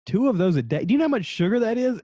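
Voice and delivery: high, loud disbelieving outburst; mock incredulity. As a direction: imitate an appalled friend demanding an answer. Acting Tom's disbelief at the sugar.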